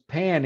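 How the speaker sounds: pan with an aspirated English P. Shown as a demonstration. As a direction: say "pan" with a puff of air on the p. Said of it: "there's more air coming out".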